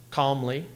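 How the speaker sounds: "calmly" with CALM stressed and -ly light. Shown as a demonstration. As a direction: mark the trochee in "calmly". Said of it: "followed by another trochee".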